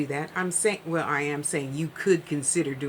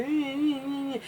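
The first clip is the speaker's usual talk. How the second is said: reluctant whine; 9 semitones higher than usual. Pretend. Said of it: "And you say".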